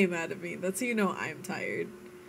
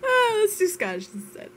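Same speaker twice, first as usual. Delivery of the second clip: laughing through the words; giggling it out while changing the subject; laughing suggestion; 12 semitones higher, 5 dB louder than usual. Real, not acted.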